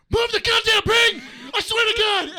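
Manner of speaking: high, loud outburst; furious yelling. Retold or imitated. Frustrated buddy to unresponsive Brian, yelling. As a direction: snap and yell it at the top. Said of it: "Who is he yelling at?".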